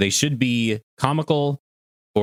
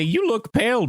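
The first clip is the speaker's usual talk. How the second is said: mock Popo voice; 10 semitones higher than usual. Repeating Popo quote.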